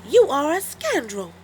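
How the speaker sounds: high pig voice, cross; Missus Pig scolding. Acted. Missus Pig scolds the wolf, high cross voice. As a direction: scold him sharply. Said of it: "said Missus Pig".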